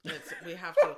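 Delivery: real laughter bursting through the words; laughing. Real, not acted. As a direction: crack up while saying it. Cracking up about filming Kevin.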